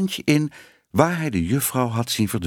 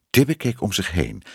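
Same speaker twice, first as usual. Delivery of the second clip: usual speaking voice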